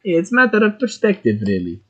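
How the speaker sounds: high, lightly attempted German accent dropping at the end; unconvincing German accent; failed German accent. Shown as a demonstration. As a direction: try a German accent and miss. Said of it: "apparently I can't do German accent".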